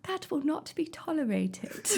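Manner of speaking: high, slow royal princess voice; disapproving princess. Acted. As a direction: reprimand slowly like a princess. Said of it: "What does a princess do".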